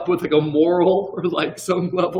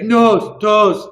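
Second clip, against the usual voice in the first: louder mock little-child talk; imitating young son; 3 semitones higher, 5 dB louder than usual. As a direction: imitate a small son naming body parts, word by word. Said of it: "that's how he talks".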